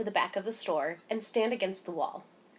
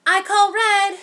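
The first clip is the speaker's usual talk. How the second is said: much higher and louder, eager call; excited claim; 12 semitones higher, 13 dB louder, slower than usual. A narrator's reading. Charlotte grabbing the red polish, high and loud. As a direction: shout dibs on the red like a kid.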